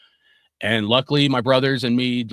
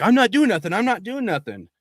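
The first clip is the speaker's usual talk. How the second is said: mocking the punks; 9 semitones higher than usual. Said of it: "They're like".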